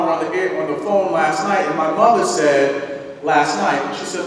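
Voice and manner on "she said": usual speaking voice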